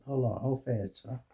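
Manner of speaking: normal talking voice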